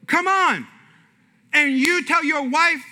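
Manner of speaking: usual speaking voice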